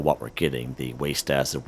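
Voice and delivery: ordinary delivery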